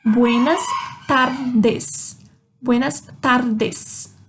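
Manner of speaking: "tardes" slowly, the r tapped by the tongue; slow, careful "tardes" with tremor on r. Shown as a demonstration. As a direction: separate the words, flutter the r in "tardes". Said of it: "eu faço um tremido quando eu falo de tardes".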